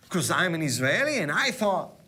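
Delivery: ordinary delivery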